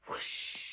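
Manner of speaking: whispered rush of wind; blowing whoosh. Acted. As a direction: blow out a gust of air. Wind sound of the puff.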